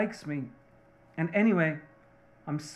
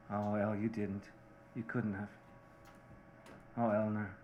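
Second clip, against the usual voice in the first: much softer, lower disbelieving murmur; hushed dismay; 6 semitones lower, 10 dB quieter than usual. Acted. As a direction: let it sink in, barely aloud. Listener dismayed at the confession.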